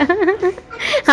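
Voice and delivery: a sudden high-pitched giggle. Real, not acted. Wife laughing at husband's temper, high giggle. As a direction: burst into a squeaky laugh.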